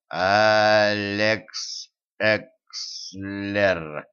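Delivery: very slow, sounding out each syllable. A narrator's reading. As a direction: spell it out slowly, one syllable at a time. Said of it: "по слогам прочитал".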